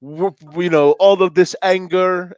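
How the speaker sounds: lightly imitating the DJ's speech; [name] impression. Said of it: "he's talking like".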